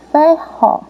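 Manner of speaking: "ferro" in two separate beats; separação silábica. Shown as a demonstration. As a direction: pause between the syllables of "ferro": fer, ro. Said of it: "nós vamos repetir". ("ferro" dito em duas sílabas separadas)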